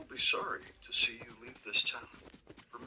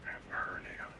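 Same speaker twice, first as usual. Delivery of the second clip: hushed confession of a hernia; barely voiced, embarrassed whisper; 8 dB quieter than usual. Real, not acted.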